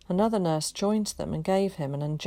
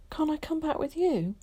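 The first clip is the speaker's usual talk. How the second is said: put-on pleading boy; 11 semitones higher than usual. A narrator's reading.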